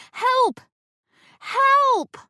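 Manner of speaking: very high, loud cry for help; panicked yell. Acted. Narrator calls for help, panicking.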